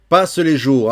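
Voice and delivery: vers repris plus fort; poem reading. Shown as a demonstration. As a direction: lire le refrain avec force. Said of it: "lecture du poème". (lecture de poème appuyée)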